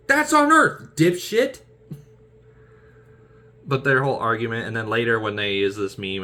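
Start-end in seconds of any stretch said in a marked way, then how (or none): 0.1-1.6 high, loud scoff; mocking put-down. Retorting that Missouri is on Earth, high loud sneer. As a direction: sneer the insult. Quoted movie dialogue.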